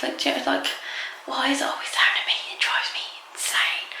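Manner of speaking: exasperated hushed whisper; whispering while hiding. Real, not acted. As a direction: vent under your breath, hidden. Hiding in bathroom, venting quietly.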